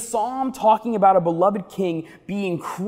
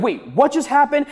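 mock surprise; 6 semitones higher than usual. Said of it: "you're like".

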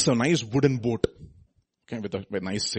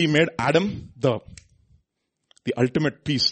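ordinary talk, nothing marked

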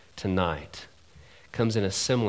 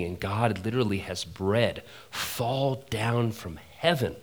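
normal talking voice

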